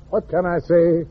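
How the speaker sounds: ordinary delivery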